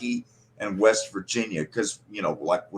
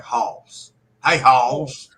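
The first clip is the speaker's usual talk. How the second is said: Kentucky drawl; 3 semitones higher, 6 dB louder, much slower than usual. Shown as a demonstration. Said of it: "you're almost getting an L in there".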